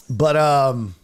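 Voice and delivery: ordinary talk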